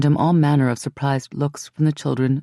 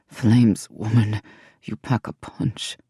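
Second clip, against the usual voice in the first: lower, rough-edged man's voice; deep male voice; 5 semitones lower, slower than usual. A narrator's reading. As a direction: voice the king low and steady.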